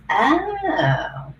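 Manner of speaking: long, high-pitched "oh"; dawning interest. Retold or imitated. Coach retelling hearing of leadership coaching, intrigued realisation.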